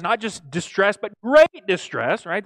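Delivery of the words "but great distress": "great" slowed and punched loud; stress on great. Shown as a demonstration. Preacher to congregation, stressing "great" slowly and loudly. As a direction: lean hard on "great" in "great distress". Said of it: "There's the emphasis there".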